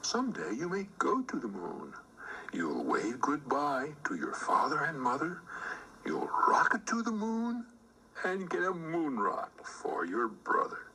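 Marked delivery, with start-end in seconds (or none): none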